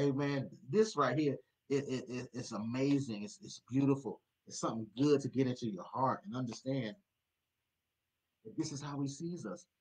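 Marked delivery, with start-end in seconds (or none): none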